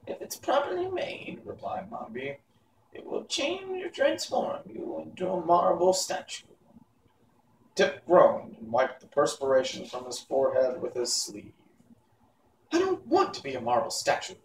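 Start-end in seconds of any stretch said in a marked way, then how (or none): 0.1-6.5 high, hushed wicked witch; whispering witch. Acted. Witch Mombi telling boy Tip his fate, high and hushed. 12.7-14.4 put-on protesting boy. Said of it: "he protested".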